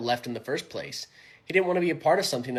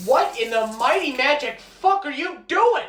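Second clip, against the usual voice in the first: high, loud outraged shout; shocked yell; 11 semitones higher, 7 dB louder than usual. Acted. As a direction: yell it in disbelief. Friend finds cards being washed.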